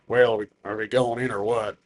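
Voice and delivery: low-pitched big-guy voice. Acted. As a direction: play the big guy waiting to go in. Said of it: "just a big guy like this".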